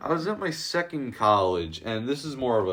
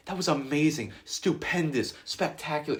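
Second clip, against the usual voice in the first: higher-pitched mock gush; gushing praise; 5 semitones higher than usual. Substitute teacher praising the school circus, gushing. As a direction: imitate an over-impressed teacher.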